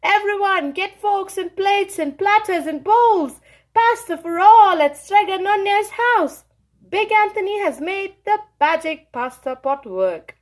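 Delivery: high, loud call to the whole square; shouting to the town. Acted. Big Anthony calls the townspeople, loud proud shout. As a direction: announce it from the fountain. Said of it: "and shouted".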